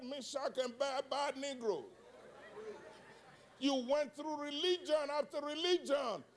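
high, driving sermon delivery; full-force preaching. Real, not acted. Preacher promising the congregation open eyes at communion, full-force preaching. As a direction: preach it high, stretching the climactic words.

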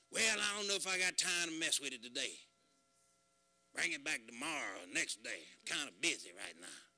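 lightly voiced brush-off, dropping low and soft; a hint of a busy mechanic shrugging him off; grumbling mechanic. Preacher voicing the rough mechanic who turns him away, low and soft. Man just kinda rough with me.

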